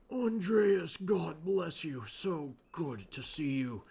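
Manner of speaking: lightly voiced old monk, slow; slow it down like an old man, only a touch; a hint of an elderly brother, slow and drawn out; older voice. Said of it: "give him a, an older, older voice".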